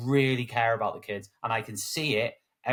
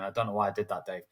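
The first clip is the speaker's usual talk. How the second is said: ordinary talk, nothing marked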